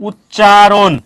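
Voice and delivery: "উচ্চারণ" drawn out, long first vowel; emphatic, stretched "উচ্চারণ"; sounding out. Shown as a demonstration. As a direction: say "উচ্চারণ" slowly and firmly for learners. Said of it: "উচ্চারণ বানানে কি আছে".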